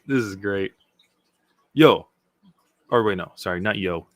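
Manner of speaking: usual speaking voice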